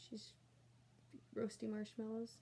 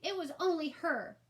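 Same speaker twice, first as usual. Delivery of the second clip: put-on much higher, louder kid voice; accusing little brother; 7 semitones higher, 6 dB louder than usual. A narrator's reading. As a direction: snap out the accusation. Brother blames sister.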